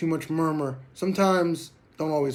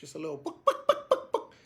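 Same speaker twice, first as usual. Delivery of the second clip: breathy, mostly unvoiced buck buck with a laugh; little goose buck calls; 14 semitones higher, 3 dB quieter than usual. Shown as a demonstration. Goose-calling instructor imitating a bird on the ground, breathy bucks. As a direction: chuckle through soft goose bucks. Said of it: "you hear a bird on the ground".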